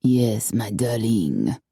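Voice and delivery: low, slow, seductive murmur; a deep lover's purr; low male voice. A narrator's reading. The intruder answering Corky, low seductive murmur. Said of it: "a low voice answered".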